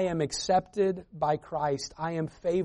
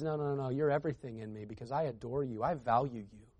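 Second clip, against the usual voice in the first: soft, tender, reassuring and much softer; gentle divine reassurance, softer toward the end; tender voice of God; 2 semitones lower, 8 dB quieter than usual. Retold or imitated. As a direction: speak as a loving father comforting a child. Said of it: "God says".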